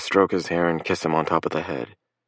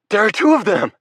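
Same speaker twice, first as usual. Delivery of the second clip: even higher, louder panicked yell; frantic yell; 6 dB louder than usual. A narrator's reading. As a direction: yell it at the doctor, losing control. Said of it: "why I'm yelling at him".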